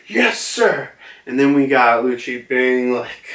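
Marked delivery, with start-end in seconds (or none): 0.1-0.9 high, eager soldier's yes sir; grunt reply. Retold or imitated. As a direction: snap back like a grunt.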